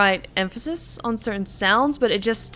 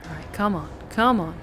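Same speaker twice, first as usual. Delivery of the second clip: ordinary delivery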